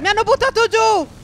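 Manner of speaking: loud, high cry of alarm; panicked outcry. Real, not acted. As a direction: shout for help as you go down. Knocked down in the game.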